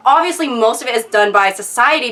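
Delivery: ordinary talk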